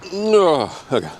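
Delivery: wincing at sour taste; eating sour candy. Real, not acted. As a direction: sour shock, squeaky oh. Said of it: "glad you can't see my face".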